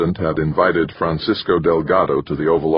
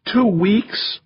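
very high, bleating wife's question; bleated. A narrator's reading. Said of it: "she bleated".

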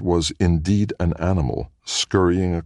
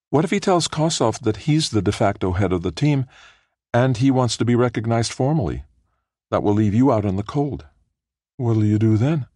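lightly voiced worried wife; 7 semitones higher than usual. Felicia's voice read higher.

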